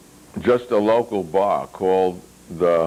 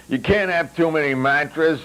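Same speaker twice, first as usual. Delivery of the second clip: higher, lecturing Mogul voice; Mogul's voice; 7 semitones higher than usual. A narrator's reading. Mogul holding forth to his table, pitched higher and preachy. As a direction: lay down the law like a guru.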